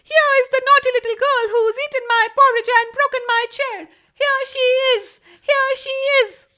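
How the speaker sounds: put-on high squeaky Baby Bear cry; tiny voice as loud as he could. A narrator's reading. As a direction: shout it with a tiny voice at full power. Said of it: "making his tiny little voice as loud as he could".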